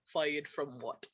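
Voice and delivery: deep, quiet German-Russian dad voice; mock Germanic accent, low and soft; dad's attempted German accent. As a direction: drop low for an imitation German father. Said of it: "try to make him sound Russian".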